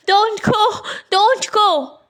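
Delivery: high, pleading seagull cry; a lightly voiced seagull crying a warning. A narrator's reading. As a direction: call out high to stop her going. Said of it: "cried the seagulls".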